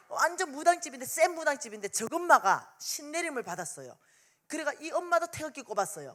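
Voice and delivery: ordinary talk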